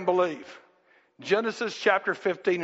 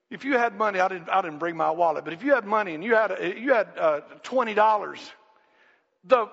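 usual speaking voice

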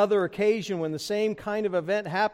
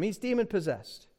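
usual speaking voice

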